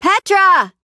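high, loud call. Acted. Adventurer hailing a companion in the caves, calling out. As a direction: shout for a missing friend, high and loud.

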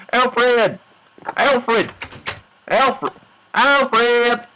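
high, repeated hail; calling out. Pretend. Player looking for Alfred in the barn, calling out. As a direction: call someone from across a barn.

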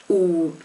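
U said as a pure oo; U, rounded pure oo; U demonstrated for learners; Swiss German U. Shown as a demonstration. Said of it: "just pronounced as an U".

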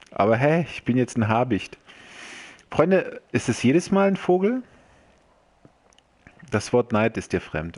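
normal talking voice

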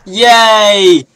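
high, loud, drawn-out yay; excited cheer. Real, not acted. Gamer cheering a favourite line from the game, excited cheer. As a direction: cheer it out loudly.

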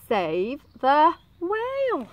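slow, deliberate reading; slow spelled-out message. A narrator's reading. Children read the snail's trail, slow deliberate voice. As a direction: read it out word by word, slowly.

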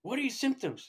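high, loud retold questioning; upset doctor. Doctor demanding symptoms from the patient, upset. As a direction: imitate a doctor's agitated demand. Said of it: "she's all upset".

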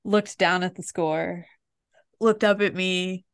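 ordinary talk

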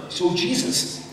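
ordinary talk, nothing marked

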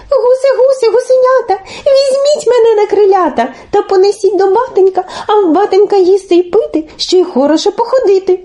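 put-on high, quick child-like chant; pleading. A narrator's reading. Telesyk begs the geese to carry him home, high pleading. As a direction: beg in a small high voice. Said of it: "А телесик і просить".

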